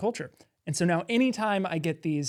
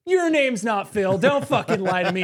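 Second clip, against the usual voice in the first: mock confrontation; 4 semitones higher, 6 dB louder than usual. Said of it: "And I said".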